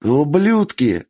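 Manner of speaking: slow, drawn-out furious yell; angry yell. Acted. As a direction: roar it at the enemies. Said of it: "проорал Бенджиро".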